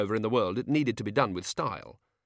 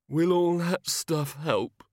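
mumbled; 8 semitones higher, 4 dB louder, much slower than usual. A narrator's reading. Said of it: "he mumbled".

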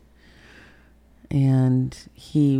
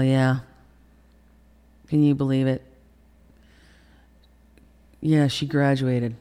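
smart-ass phone chat; 3 dB louder than usual. Retold or imitated. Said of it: "hearing him be smart ass".

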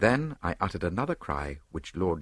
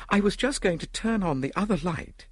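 high-pitched woman recounting an attack; shaken victim; 11 semitones higher than usual. A narrator's reading. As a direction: tell it high, still shaken. Shaken victim tells her story.

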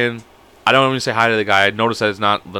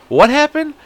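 pitched way up, caught off guard; startled disbelief; 4 dB louder, slower than usual. Retold or imitated. Confused customer startled by the clerk's accusation, high surprised blurt. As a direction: blurt it out, totally thrown.

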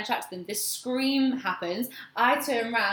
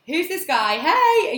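excited greeting; 7 semitones higher, 9 dB louder, slower than usual. Retold or imitated. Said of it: "and is like".

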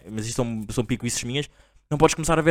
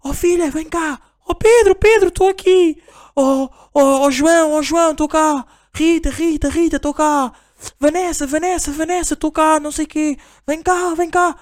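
much higher mock calling across a schoolyard; hammed-up parents shouting for their kids, high pitched; high, loud mock calling of kids' names; parents yelling names; 4 dB louder than usual. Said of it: "estão, tipo, ali a gritar".

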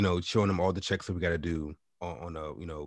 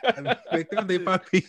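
much higher, louder comic mimicry; imagined detainee; 11 semitones higher, 6 dB louder than usual. Friend repeating the detainee's promise for laughs, high-pitched mimicry. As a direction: push the imitation up high, amid laughter. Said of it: "I can just imagine him saying".

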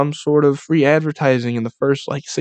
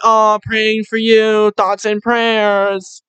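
slow, higher, sappy fake-caring voice; mock sympathy; 8 semitones higher, much slower than usual. Mocking online commenters, syrupy fake sympathy. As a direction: drag out the fake concern. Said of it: "they're like".

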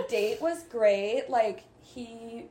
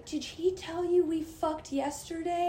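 retold scream; 7 semitones higher than usual. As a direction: imitate her screaming in a high voice. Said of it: "Like, screaming".

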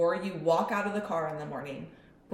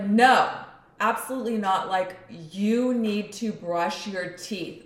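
stern scolding; 3 semitones higher, 6 dB louder, slower than usual. Retold or imitated. Mom scolding son over teeth.

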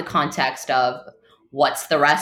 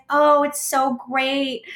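slow, much higher gushing voice, lightly mimicked; gushing guest; 9 semitones higher, slower than usual. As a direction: play the impressed rich girlfriend, drawn out.

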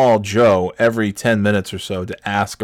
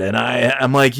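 ordinary delivery

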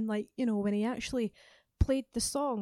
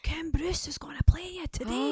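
higher, mock-excited announcement; imitated excited friends; 5 semitones higher than usual. Singer voices friends calling with radio news, excited.

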